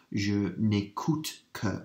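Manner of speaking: je n'écoute que, drawn out slowly; slow French demo. Shown as a demonstration. Teacher modelling je n'écoute que slowly for students. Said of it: "ne and que together only".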